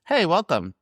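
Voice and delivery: a hint of a Wizard of Oz munchkin; mild mock munchkin greeting. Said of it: "you can't talk like this".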